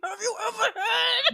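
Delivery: high voice breaking with laughter; laughing question. Real, not acted. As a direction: ask while cracking up. Laughing while asking.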